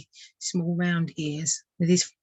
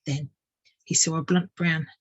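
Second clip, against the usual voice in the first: ordinary delivery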